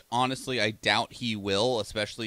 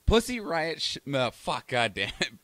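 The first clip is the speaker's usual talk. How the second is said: ordinary talk, nothing marked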